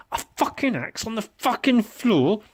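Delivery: ordinary delivery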